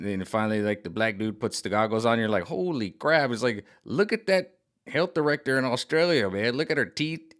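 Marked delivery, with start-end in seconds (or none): 2.4-5.6 mock shocked gasp, higher. Seeing the truth through the goggles, a mock shocked cry. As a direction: act stunned by what you see.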